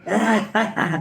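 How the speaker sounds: high-pitched mock taunt; childish gloating. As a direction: crow over a beaten enemy. Gloating over dead fire ants.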